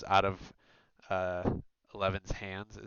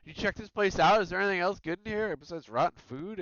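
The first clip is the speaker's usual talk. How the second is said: higher, louder gruff searching call; rough thug voice; 10 semitones higher, 6 dB louder than usual. Acted. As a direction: call out to your crew. Thugs searching warehouse.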